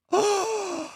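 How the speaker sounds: high sharp gasp of shock. Retold or imitated. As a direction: gasp in horror. Realizing missed reservation.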